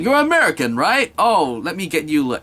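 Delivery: a bouncy high Irish accent, clearly put on. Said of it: "screw the accent".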